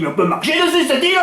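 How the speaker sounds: high, insistent retold question; astonished question. Visitor asking a Corsican if the island is walkable, astonished. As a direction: ask it in high-pitched disbelief.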